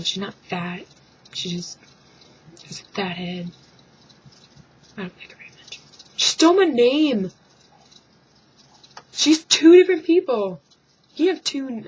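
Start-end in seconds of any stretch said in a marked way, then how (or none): 2.7-5.8 whispered, as if not to be overheard; whispered aside. Real, not acted. Vlogger dissing [name] behind her back, whisper. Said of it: "aside about her". 6.2-11.8 loud, high indignant shout; outraged rant. Real, not acted. Vlogger ranting about [name] taking her name, loud.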